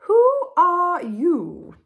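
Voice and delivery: high, loud, drawn-out posh queen; very grand voice. A narrator's reading. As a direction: ask it slowly, with regal grandeur. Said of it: "said a very grand voice".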